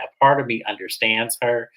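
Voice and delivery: ordinary talk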